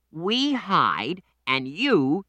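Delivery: put-on high, slow boy explaining; patient child voice; little boy voice. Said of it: "said Danny".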